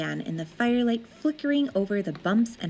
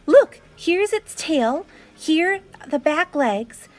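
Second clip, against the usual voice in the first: loud, high, slow pointing out; child drawing on the wall, eager; eager showing; 7 semitones higher, 5 dB louder than usual. A narrator's reading. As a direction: show each part with care.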